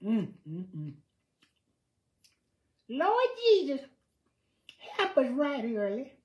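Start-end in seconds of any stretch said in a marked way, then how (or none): 2.9-6.1 high, loud praise-shout voice; church lady voice. Pretend. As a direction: cry out to the Lord like an old church mother. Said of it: "when I talk like that".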